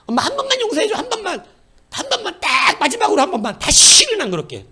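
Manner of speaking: put-on very high child voice pleading; begging child.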